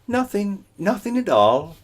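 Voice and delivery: high, breezy and dismissive; high carefree coyote; airy. Acted. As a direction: brush it off lightly. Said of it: "Coyote said airily".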